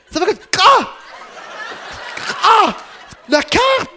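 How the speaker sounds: sharp high gasping cries of cold shock; shocked gasps. Retold or imitated. Shocked by icy shower, high gasping cries. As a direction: gasp as the icy water hits.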